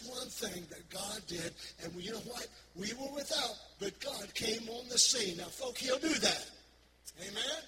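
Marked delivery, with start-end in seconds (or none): 2.7-7.6 rising preacher's cadence, louder over the music; preaching. Acted. Pastor to congregation, preaching louder and higher. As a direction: lift the church with it, building.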